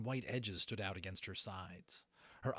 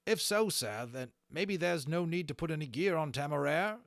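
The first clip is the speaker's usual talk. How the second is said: high, louder polite officer; Holland's voice; 7 semitones higher, 7 dB louder than usual. Acted. Holland tells Lawrence no gear needed, high and loud. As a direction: offer it brightly to Lawrence. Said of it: "that was Holland talking the whole time".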